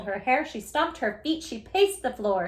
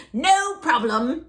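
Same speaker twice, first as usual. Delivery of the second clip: witch voice; 4 semitones higher, 7 dB louder than usual. Acted. Witch checks ingredient list.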